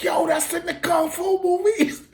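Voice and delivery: high, excited exclamation. Retold or imitated. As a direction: blurt it out, thrilled. Quoting his own excitement seeing baos.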